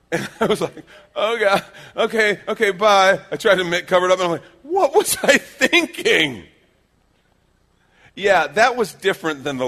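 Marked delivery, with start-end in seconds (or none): 1.1-3.2 high, loud flustered retreat; mock panic. Boy fleeing his dad's look, mock panic. 4.6-6.4 high, slow, loud mock wail; exasperated self-reproach. Boy caught out by his dad, exasperated mock wail.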